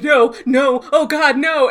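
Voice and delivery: hammed-up cries of being killed; mock dying pleas. Sam fakes his death over comms, mock panic.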